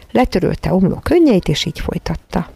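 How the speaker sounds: ordinary delivery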